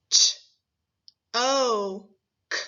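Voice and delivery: "Ch-, o-k" split into its sounds, one by one; sounded out slowly. Shown as a demonstration. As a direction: pull "Ch-, o-k" apart so each sound can be heard.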